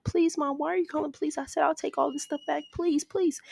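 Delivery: a daughter begging her mom not to call the police; desperate pleading. Retold or imitated. Nikita begs mom not to call.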